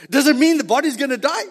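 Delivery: ordinary delivery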